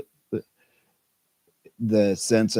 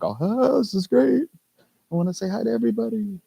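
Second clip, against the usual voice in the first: very high, giddy little voice; squeaky, hammed-up delight; mock excitement; 13 semitones higher than usual. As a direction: imitate an over-eager greeter, pitched way up. Acts out own first excited reaction.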